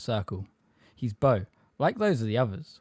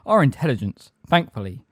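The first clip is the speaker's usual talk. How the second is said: loud, raised, forceful correction; offended rebuke; 5 semitones higher, 6 dB louder than usual. A narrator's reading. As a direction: rebuke with proud emphasis. Offended centaur rebukes the official.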